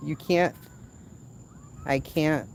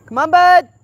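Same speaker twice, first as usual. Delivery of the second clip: calling out; 17 dB louder than usual. Real, not acted. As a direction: shout it across the yard. Calling son to come.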